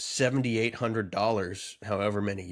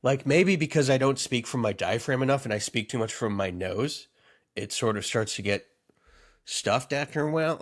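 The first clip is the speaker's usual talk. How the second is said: ordinary talk, nothing marked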